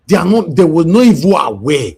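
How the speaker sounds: indignant, raising pitch and volume in disbelief; real anger. Real, not acted. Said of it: "me more angry".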